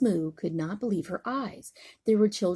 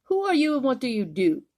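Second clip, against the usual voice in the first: high, quick and louder, snooty giraffe; not very nicely; 6 semitones higher, 6 dB louder, faster than usual. Acted. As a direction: look down your long neck and ask it sharply. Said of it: "but not very nicely".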